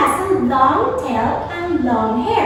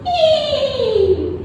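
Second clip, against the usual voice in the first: high-pitched imitated whinny; a long high "eee", falling like a neigh; horse neigh; 9 semitones higher than usual. Shown as a demonstration. Teacher showing children a horse sound, high imitated neigh. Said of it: "like this".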